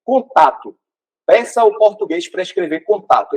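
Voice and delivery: usual speaking voice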